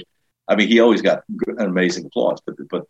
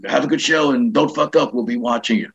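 higher-pitched, offhand pep talk with hesitations; George Carlin; 5 semitones higher than usual. Retold or imitated. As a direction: lightly imitate a distracted boss wishing luck. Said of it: "He goes".

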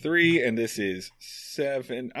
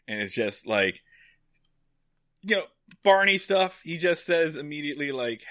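normal talking voice

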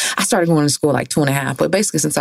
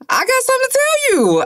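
dramatic reveal voice. Acted. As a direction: build suspense like a confession. Dramatic confession announcement.

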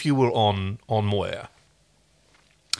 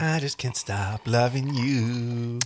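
slow lovesick line; much slower than usual. Pretend. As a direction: drag out the longing slowly. Second slow take.

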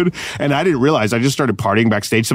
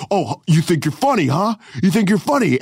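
higher-pitched needling challenge; mock confrontational; 7 semitones higher than usual. Security guard challenging the comedian, mock confrontational. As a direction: imitate a guard squaring up.

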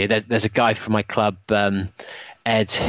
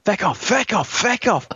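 high-pitched mock yell, FECK in place of the swear; aggressive mock shout, censored; 9 semitones higher than usual. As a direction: re-enact the yelling with a softened "feck", pitched up. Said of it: "I was shouting at it very aggressively".

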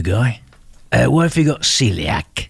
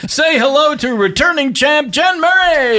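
announcer voice; 12 semitones higher than usual. Acted. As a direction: hype the name like a ring announcer. Host announcing returning champ.

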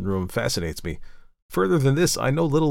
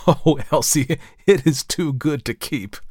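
amused, higher and slowing as if laughing; 5 semitones higher, 5 dB louder than usual. A narrator's reading. Brother teases sister about her mistake, laughing. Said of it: "laughed George".

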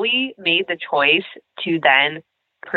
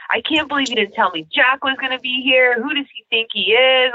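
whiny Dawson; 7 semitones higher, faster than usual. Retold or imitated. Mocking Dawson's whining.

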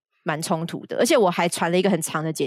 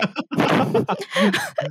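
high laugh. Real, not acted. Laughing after retort.

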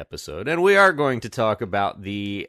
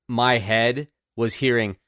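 ordinary talk, nothing marked

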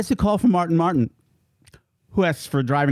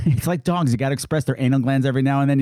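ordinary talk

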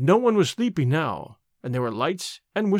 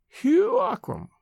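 put-on much higher, slow, thin old woman's call; little faraway voice; slower than usual. A narrator's reading. Ancient nurse calling the dying man, thin and faraway. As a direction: call him from far away, frail. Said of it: "the little faraway voice".

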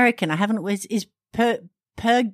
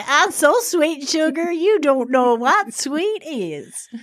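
mock Southern belle drawl; hammed-up Southern belle, slow; playful fake Southern accent; Scarlett O'Hara; 5 semitones higher, slower than usual. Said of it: "possessed then by Scarlett O'Hara".

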